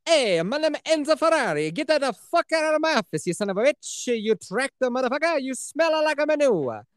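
hammed-up Italian accent, pitched high; Enzo Ferrari. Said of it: "with an Italian accent".

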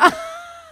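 shrill laugh; high burst of laughter. Real, not acted. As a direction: laugh out in disbelief. Laugh after quote.

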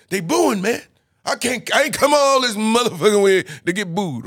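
agitated complaint. Retold or imitated. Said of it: "He was like".

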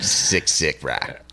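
low, slow put-on bro voice; Seth. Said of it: "You sound like Seth".

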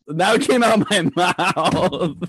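high, laughing through the words; loud laughing disbelief. Real, not acted. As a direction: giggle the line out. Laughing at own story.

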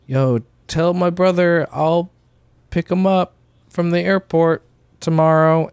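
mock casual code phrase on the phone; coded distress call. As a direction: sound breezy while hiding a warning. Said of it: "I'll be like".

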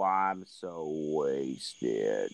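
put-on very slow, low, slurring drunk. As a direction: drag it out like you're wasted. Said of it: "now he's doing it on purpose".

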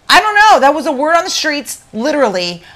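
high, loud, panicked self-defence; flustered excuse. Retold or imitated. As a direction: blurt out the excuse, voice jumping up. Retells flustered excuse after blunder.

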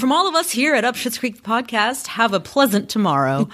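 fast, high TV anchor sign-off; news anchor. Acted. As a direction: wrap it up like the evening news. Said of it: "sound like an anchor closing the news".

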